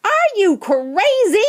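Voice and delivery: high, loud, drawn-out incredulous cry; shout. A narrator's reading. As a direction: shout it slowly in disbelief. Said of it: "shouted a woman".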